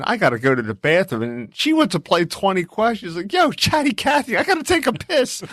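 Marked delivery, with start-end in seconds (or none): 3.3-5.4 high-pitched comic griping; mock exasperation. Husband desperate for the bathroom, mock exasperated. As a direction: plead to get past, voice pitched way up. Acted complaint to his chatty wife.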